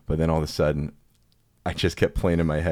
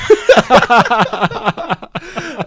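long high burst of laughter; real loud, high laughing fit; 14 dB louder than usual. Real, not acted. Laughing at own dental story.

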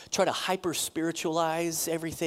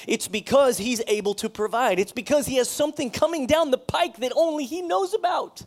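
rising, louder preaching; 5 semitones higher, 5 dB louder than usual. Real, not acted. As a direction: build to a fervent close. Preaching at full force.

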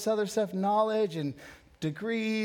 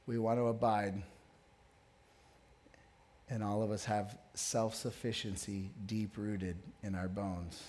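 lower, softer closing prayer; quiet prayer; 11 semitones lower, 7 dB quieter than usual. Real, not acted. Pastor leads the congregation in a soft closing prayer.

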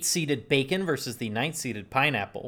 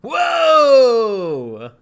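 surprised shout; 10 semitones higher, 12 dB louder than usual. Real, not acted. Host shocked by Home Alone joke.

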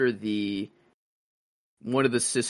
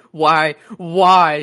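mock wail, louder and higher; hammed-up heartbroken plea; anguished cry; 6 semitones higher, 9 dB louder than usual. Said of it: "she's like".

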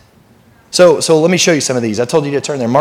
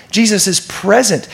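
ordinary delivery

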